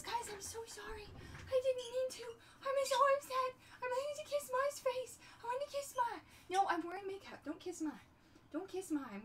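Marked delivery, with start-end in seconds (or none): 0.0-6.2 high whiny voice of a sorry dog; pet's voice. Acted. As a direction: speak as the guilty pet, crying a little. Voicing the pet's apology.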